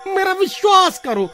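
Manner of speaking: high, insistent plea; desperate pleading. Acted. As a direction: beg to be believed. Begs skeleton to believe him. (गिड़गिड़ाकर यक़ीन दिलाते हुए)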